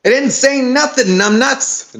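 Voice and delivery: high, snappy Brooklyn shout; Brooklyn accent. Acted. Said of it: "can't get an accent down for Delilah".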